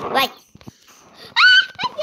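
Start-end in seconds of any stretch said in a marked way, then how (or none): 1.3-2.0 laughing shout. Real, not acted. Laughing shout at game mishap.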